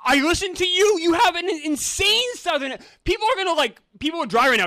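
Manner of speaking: high, loud, exasperated insistence; heated protest. Real, not acted. Arguing that his friend sounds insanely Southern, heated protest. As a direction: raise your voice in disbelief. Heated teasing argument over accent.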